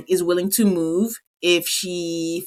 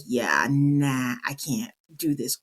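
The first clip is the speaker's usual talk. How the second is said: imitating a whispered no; 6 semitones lower, 5 dB quieter, slower than usual. Her rejection voiced softly.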